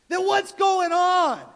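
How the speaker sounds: high, loud, slow demand; exasperated king. Retold or imitated. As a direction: burst out at your men, drawing out each word. Retold king's outburst at soldiers.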